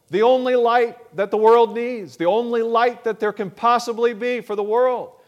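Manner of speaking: loud, high, ringing proclamation; preaching at full force. Acted. Preacher driving home the point to the congregation, loud and high. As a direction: bring it home to the back pew.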